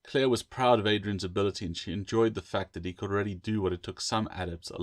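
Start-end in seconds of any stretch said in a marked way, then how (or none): none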